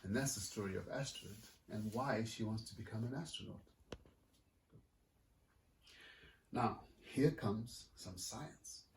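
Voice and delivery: lively picture-book reading; story narration. A narrator's reading. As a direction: read it like to a room of kids. Author reads picture book aloud.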